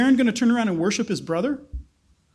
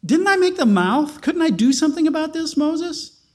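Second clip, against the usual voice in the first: higher, insistent voice of God pressing Moses; God rebuking Moses; 7 semitones higher than usual. Acted. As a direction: lift the voice as God challenging a reluctant servant. Preacher voicing God rebuking Moses.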